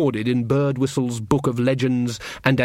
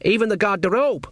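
higher-voiced, helpful little addition; reasonable; 9 semitones higher than usual. A narrator's reading. Servant lists his chores to the queen, reasonable. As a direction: offer the detail innocently. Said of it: "said Sean in a reasonable voice".

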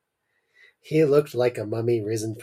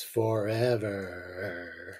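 dramatic slow reveal; 3 semitones lower, 8 dB quieter, much slower than usual. Shown as a demonstration. Reading the one-word title to a friend, slow and ominous. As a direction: draw out forever slow and soft. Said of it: "That's all it's called".

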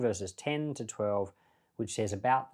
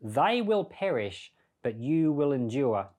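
scripture quote; 2 semitones higher, 4 dB louder than usual. Acted. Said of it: "it says".

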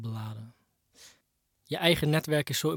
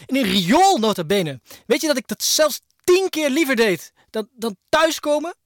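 louder and higher, building to a furious cry; angry outburst; 5 semitones higher, 6 dB louder than usual. Acted. Man venting years of rage at his ex, shouting higher and higher. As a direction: let the grievance explode at her.

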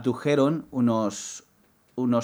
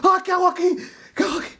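high, startled sleepwalker cry; panic, half-asleep and lost; confused panic; much faster than usual. Retold or imitated. Waking on the sofa, crying out confused to himself. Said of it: "me levanto y digo".

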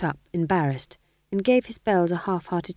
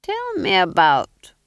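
syrupy Duke; 5 dB louder, much slower than usual. Acted. Duke sweetly coaxing the fool about witches, slow and syrupy. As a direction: pour it like treacle. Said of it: "voice dripping ... treacle over a pudding".

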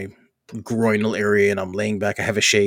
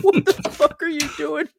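much higher, laughing mock outrage; laughing disbelief. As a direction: imitate the boss's stunned laugh. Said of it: "He's like".

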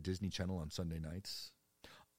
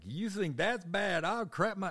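mock joke; 13 semitones higher, 7 dB louder, slower than usual. Mock joking reply in store.

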